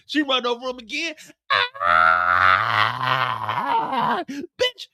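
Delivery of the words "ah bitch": crushed, squeezing out words; strangled pain. Retold or imitated. Hitman crushed.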